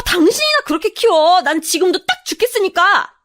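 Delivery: high-pitched, pouring out grievances; furious tirade. Acted. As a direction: lash out, climbing to a loud peak. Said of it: "저는 생각나는 대로 마구 퍼붓다가".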